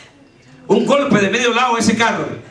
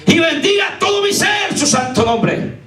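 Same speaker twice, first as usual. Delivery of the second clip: high, drawn-out testimony cry; full-force preaching; 9 semitones higher than usual. Acted. Preacher testifying to the congregation, full-force preaching. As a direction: preach it out with rising fervor.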